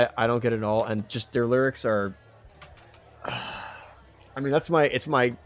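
ordinary talk

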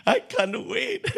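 high squealing laugh, drawn out slowly; laughing excitedly. Acted. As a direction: giggle it out, high and slow.

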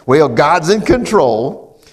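loud, drawn-out mock of churchgoers; mimicking pat saying. Preacher imitating what some people say, loud drawn-out mimicry. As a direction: stretch it out loudly, imitating a stock saying. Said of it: "Some people says".

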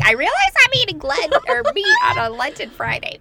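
high, loud hammed-up announcement; mock shout. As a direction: blurt it out like a silly confession. Mock confession in a play voice.

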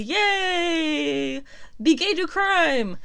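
high, bright cheer; excited cheer. Real, not acted. Cheering bisexual news.

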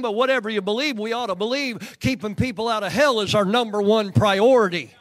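loud, driving revival preaching; forceful preacher's delivery. Real, not acted. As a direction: press the point on the congregation with full voice.

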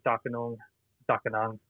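Darkinjung as "Darkinoong", no J, said twice; elder's pronunciation. Shown as a demonstration. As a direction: demonstrate Darkinjung without the J, ending in a rounded "ung". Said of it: "Elder Tommy Sales' pronunciation".

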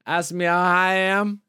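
ordinary delivery

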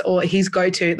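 ordinary talk

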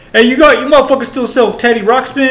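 high, loud, obnoxious customer voice; rude customer. Retold or imitated. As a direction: sneer it like the jerk customer. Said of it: "you just get some asshole".

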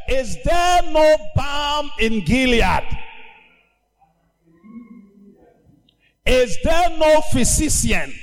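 preacher's lament; preaching cry. Acted. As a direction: call it out over the congregation. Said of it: "Then he said".

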